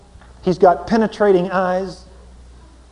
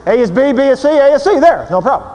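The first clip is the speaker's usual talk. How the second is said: loud, pushy reasoning; mock cold logic; 6 semitones higher, 6 dB louder, faster than usual. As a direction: hammer the logic home. Mocking husband's cold logic.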